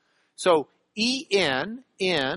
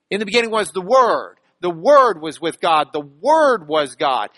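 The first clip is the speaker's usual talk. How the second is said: each "Word" punched louder, with a falling tune; preacher hammering the word "Word" in every clause; stress on Word; 3 semitones lower, 4 dB louder than usual. Shown as a demonstration. Pastor to congregation, leaning hard on each "Word". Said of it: "look at how he repeats the word".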